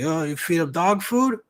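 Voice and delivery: high, loud imitation of a stranger; nosy stranger. Stranger questioning a dog owner, high mocking voice.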